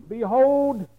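a loud, ringing behold; loud proclaim. Acted. Preacher reading the brothers' words, called out loud. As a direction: call it out to the crowd.

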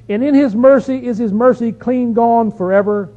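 loud, ringing Bible reading; scripture reading. Acted. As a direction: proclaim the psalmist's question to the congregation. Reads psalm's questions aloud.

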